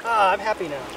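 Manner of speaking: normal talking voice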